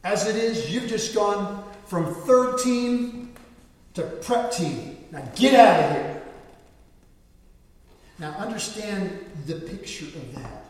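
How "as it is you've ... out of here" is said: loud, higher contemptuous rebuke; coach dressing down. Retold or imitated. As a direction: coach sending a player to prep team. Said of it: "He literally says these words".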